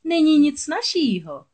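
high, loud, drawn-out slyness; oily, smirking witch. A narrator's reading. Witch telling Ariel how easy it is, oily. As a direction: slither through it slowly, pitched high. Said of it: "úlisně se usmála čarodějnice".

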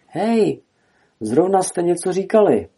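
high, playful calling out; amused call. A narrator's reading. Kenai calls to two talking squirrels, amused. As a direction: hail them with amusement, pitch lifted. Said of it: "zavolal pobaveně Kenai".

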